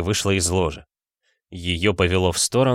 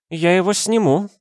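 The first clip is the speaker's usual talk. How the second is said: put-on drunk woman; 11 semitones higher, 3 dB louder than usual. A narrator's reading. Drunk Dasha.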